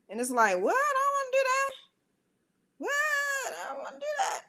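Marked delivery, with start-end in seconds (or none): 0.6-1.7 put-on very high, whiny kid voice; squeaky little-kid refusal; childish whine. Acting her whiny reluctant inner voice. 2.8-4.4 put-on very high, quieter kid voice; hushed squeaky little-kid refusal; soft childish whine. Repeated whiny inner voice, softer.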